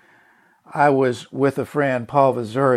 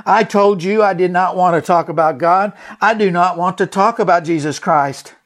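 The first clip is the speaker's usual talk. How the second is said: loud, higher mock yell of an angry woman; retold yelling; 5 semitones higher, 5 dB louder than usual. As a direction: shout it like she's yelling you off. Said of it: "she began yelling at me".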